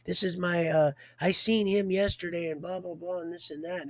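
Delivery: mocking a mom losing it; mom flipping out. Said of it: "there's a mom flipping out".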